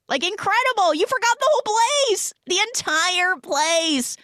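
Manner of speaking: very high, loud exclaiming; manic mock praise. Man raving that she's incredible at forgetting, high and loud. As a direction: gush at the top of the voice.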